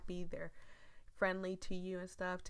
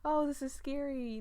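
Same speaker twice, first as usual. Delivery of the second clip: high, louder mock-frightened gasp; sarcastic fake fright; mock scared; 9 semitones higher, 6 dB louder than usual. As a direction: pretend to be spooked, hammed-up. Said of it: "I'm just like".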